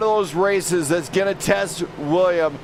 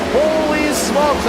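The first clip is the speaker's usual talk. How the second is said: excited; 6 semitones higher, 3 dB louder, slower than usual. Real, not acted. Excited commentator, race battle.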